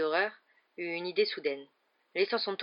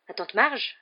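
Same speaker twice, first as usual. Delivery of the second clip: higher, louder startled question; stammering dismay; 5 semitones higher, 6 dB louder, slower than usual. A narrator's reading. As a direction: ask in stunned disbelief. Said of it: "balbutia-t-il".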